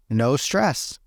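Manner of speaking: usual speaking voice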